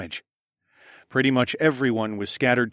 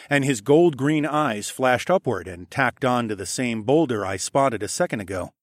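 ordinary delivery

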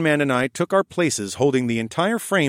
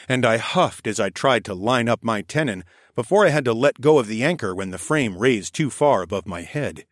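usual speaking voice

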